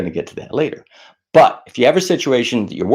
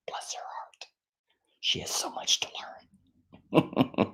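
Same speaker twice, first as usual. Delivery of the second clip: unvoiced slow whisper; whispered aside; 16 dB quieter, slower than usual. Real, not acted. Whispered aside to viewers about his daughter. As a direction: lean in and breathe it as a secret.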